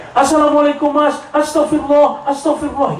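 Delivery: panicked employee. Retold or imitated. Employee phoning the boss, mock panic. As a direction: sound the alarm breathlessly.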